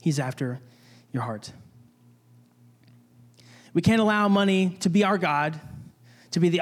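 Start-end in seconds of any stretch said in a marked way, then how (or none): 0.0-1.5 low and soft, dropping to almost nothing; fading hush. Acted. Preacher to congregation, hushed and fading. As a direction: let the words fade away quietly.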